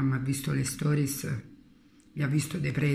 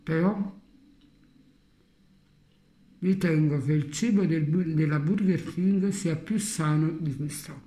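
chewing while talking; speaking around food in cheek; mouth full. Real, not acted. Seen 1: Bites at -7, cheek bulges with food while talking throughout.

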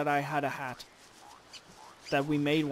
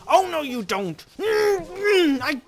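loud, much higher angry yell; furious outburst; 11 semitones higher, 10 dB louder than usual. Real, not acted. Gamer enraged as a pest steals his berries. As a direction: explode in rage.

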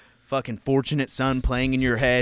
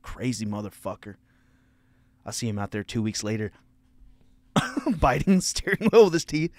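ordinary talk, nothing marked

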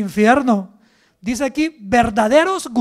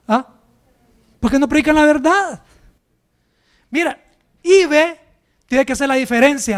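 fiery preaching; 6 dB louder than usual. Acted. Preaching at full force.

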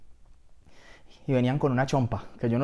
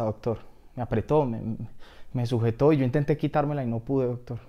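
normal talking voice

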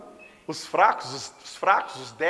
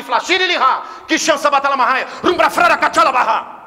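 fervent tongues; 3 semitones higher, 8 dB louder than usual. Shown as a demonstration. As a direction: let the tongues swell into a passionate cry. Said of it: "é isso aqui, ó".

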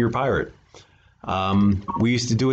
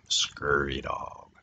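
much lower, softer, very slow scurvy dog; pirate growl; 9 semitones lower, 8 dB quieter, much slower than usual. Shown as a demonstration. Reader demonstrating the pirate way to say it. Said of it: "That's the way you have to say it".

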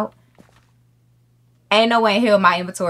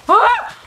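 high, loud yelp of fright; startled scream; 6 dB louder than usual. Real, not acted. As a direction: shriek as it jumps you. Jump scare in game.

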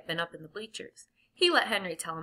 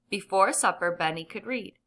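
ordinary delivery, nothing marked

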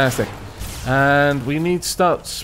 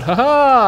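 triumphant laugh; 8 semitones higher, 7 dB louder than usual. Real, not acted. Triumphant hit on enemy.

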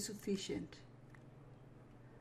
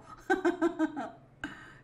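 sheepish laugh; 8 semitones higher, 8 dB louder than usual. Real, not acted. Cow is upset.

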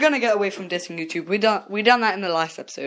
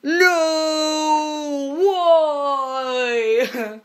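higher, loud mock wail; mock anguish; 7 semitones higher, 3 dB louder than usual. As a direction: cry out in fake despair at the spoiler. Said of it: "you're there like".

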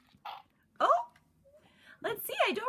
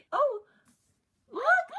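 excited; 7 semitones higher, 5 dB louder than usual. Real, not acted. Sees her real dog.

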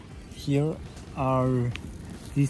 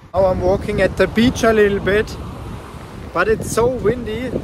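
loud; 5 semitones higher, 6 dB louder than usual. Real, not acted. Shouting over the wind on beach.

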